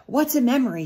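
put-on bright, eager little boy; child's question. Small boy asking the organ player. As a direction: ask like a curious child. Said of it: "he asked".

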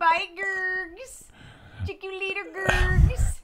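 high playful call. Pretend. Calling out goodbye.

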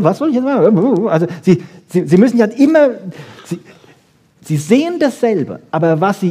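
normal talking voice

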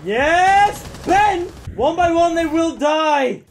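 loud, high victory cry; cheer, then declaim slowly as enemies fall; triumphant cheering. Real, not acted. Cheering teammate's kills.

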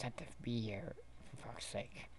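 fast irritated whisper. Acted. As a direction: hiss it under your breath. Annoyed hushed complaint.

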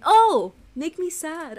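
sudden loud high "Oh!" as his mom; mock mom message. Host reads out his mom's text in a mock voice. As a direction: yelp it like a dramatic mother. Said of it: "She's telling me".

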